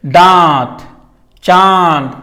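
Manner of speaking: दांत, चांद each drawn out with force, the vowel nasalised; long, stressed nasal vowels in दांत and चांद; emphatic nasal vowel. Shown as a demonstration. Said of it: "देखिए कितना sound से बोले".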